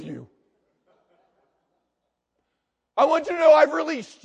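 loud, high, showy forgiveness; mock magnanimous forgiver. Churchgoer to pastor, hammed-up loud forgiving. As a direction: play the grandly forgiving churchgoer. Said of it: "walk up to him".

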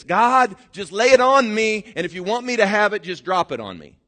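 high, loud demanding mock prayer; mock lazy prayer. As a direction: beg lazily, expecting a handout. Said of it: "come to God and say this".

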